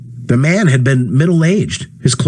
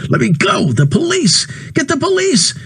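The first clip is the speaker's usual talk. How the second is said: rising higher, desperate; frantic calling. Acted. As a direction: call for the police in panic. Ed struggling, calling for police.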